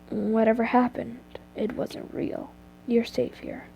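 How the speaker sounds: hushed, soothing reassurance; calm her down gently; quiet comforting murmur; soft comfort. A narrator's reading. Anti comforts you, hugging.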